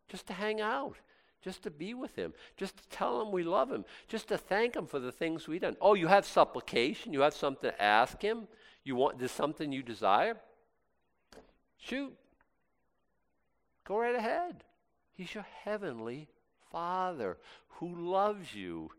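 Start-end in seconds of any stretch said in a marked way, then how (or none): 0.1-5.8 tender. Acted. Tender on intimacy with God. 11.8-18.9 reassuring. Acted. Reassuring about prayer.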